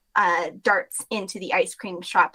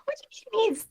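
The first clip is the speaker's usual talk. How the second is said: high, bewildered wail; high disbelieving wail; bewildered Celia. Acted. Celia fails to grasp death.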